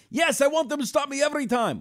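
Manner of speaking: put-on high Iranian accent, animated; Persian accent. Owner joking about airport security, Iranian accent. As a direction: insist on being stopped, Persian accent. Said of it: "I went to the accent for a minute".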